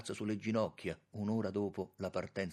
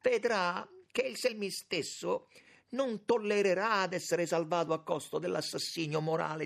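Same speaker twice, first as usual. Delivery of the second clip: high, loud, sustained indignation; indignant; 9 semitones higher, 5 dB louder than usual. A narrator's reading. Young man defends his brother against moral murder, indignant. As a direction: drive it on with outrage. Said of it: "ripigliò subito con diverso sdegno".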